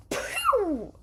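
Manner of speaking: high loud pew; mock gunshot. Gunner shooting at Ren, loud high pew. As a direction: fire off a cartoon gunshot.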